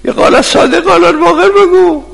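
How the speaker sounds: very high, strained heckling; high-pitched protest shouted from the audience; retold angry shout. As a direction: imitate a man yelling down the preacher. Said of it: "داد زد، گفت".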